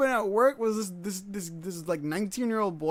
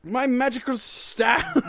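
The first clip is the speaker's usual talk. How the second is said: mock grand voice; 5 semitones higher, 4 dB louder, much slower than usual. Grand proclamation continues.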